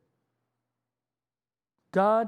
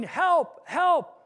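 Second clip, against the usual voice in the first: high, loud cries for help; desperate calls from a tree branch; yelling for help; 8 semitones higher, 5 dB louder than usual. Acted. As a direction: shout for help as if hanging off a cliff. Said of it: "he's yelling".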